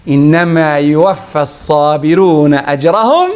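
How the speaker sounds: normal talking voice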